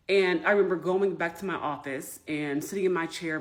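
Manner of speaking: ordinary talk, nothing marked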